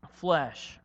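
normal talking voice

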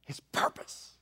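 hushed, breathy repeat of the line; whisper. Shown as a demonstration. Pastor repeating his point to the congregation, whispered. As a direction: let it drop to a bare whisper.

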